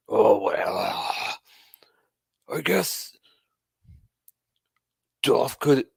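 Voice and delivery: hammed-up half-hearted boss voice; terrible Vince impression. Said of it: "I'm doing a terrible Vince impression".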